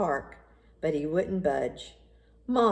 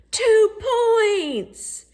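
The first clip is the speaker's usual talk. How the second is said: much higher, louder triumphant call; excited cheer; 8 dB louder than usual. A narrator's reading. Narrator celebrating Michael's winning shot, excited cheer. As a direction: announce the winning basket with joy.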